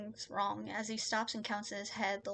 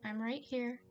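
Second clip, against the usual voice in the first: hushed, gentle reassurance; tender calming voice; soothing; 3 semitones higher than usual. Acted. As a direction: shush and comfort someone who is panicking. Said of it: "I need to calm him down".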